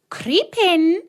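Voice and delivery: high, loud witch voice; coaxing witch. A narrator's reading. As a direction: lure her inside. Witch urges Gretel.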